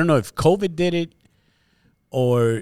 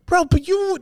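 incredulous yelp. Retold or imitated. Confronting cousin about hypocrisy.

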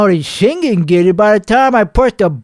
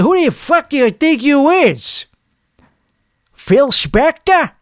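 mock tough retort; 6 semitones higher than usual. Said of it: "I said it so great delivery-wise".

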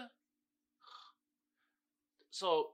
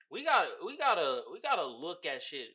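usual speaking voice

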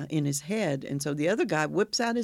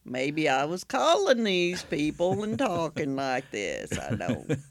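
Sling Blade; 2 semitones lower, slower than usual. Pretend. Host guessing how the calls sounded, slow movie-style drawl. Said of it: "Now I sound like Sling Blade".

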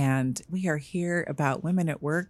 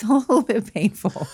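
laughing; 7 semitones higher, 4 dB louder than usual. Real, not acted. Laughing about painful growth.

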